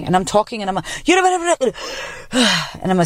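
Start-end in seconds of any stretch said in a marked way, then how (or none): 0.9-2.3 high-pitched gibberish running out into a gasp; breathless babble. Retold or imitated. Re-enacting audiobook recording, high breathless babble. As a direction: babble on until the air runs out, then gasp. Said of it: "I didn't have enough air".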